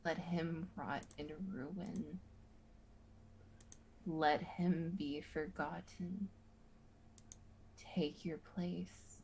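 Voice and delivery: hushed, menacing urging; tempting whisper. Acted. Tempting voice continues.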